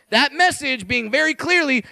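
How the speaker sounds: normal talking voice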